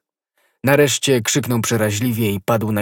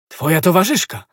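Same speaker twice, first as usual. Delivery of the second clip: higher, louder cry of alarm; alarmed exclamation; 8 semitones higher, 5 dB louder than usual. A narrator's reading. D'Artagnan learns of the companion, alarmed. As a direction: catch the shock in the question. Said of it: "zawołał D'Artagnan".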